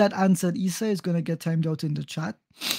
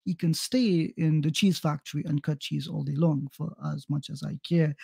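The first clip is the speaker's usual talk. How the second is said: normal talking voice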